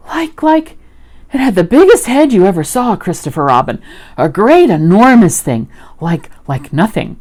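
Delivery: high, loud, flustered description; stammering, worked-up little creature; Piglet. A narrator's reading. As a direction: grope for words in excited alarm. Frightened Piglet describes the monster.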